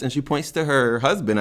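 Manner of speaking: ordinary delivery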